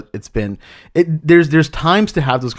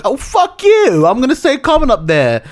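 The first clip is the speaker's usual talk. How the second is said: Jane Campion; 10 semitones higher than usual. Acted. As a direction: shout it in a shaky attempt at a New Zealand accent. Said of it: "that was my Jane Campion".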